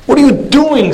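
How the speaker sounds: a city man shrieking at a farmer; retold scream. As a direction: shriek it up high in outrage. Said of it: "screams the ci-, city man".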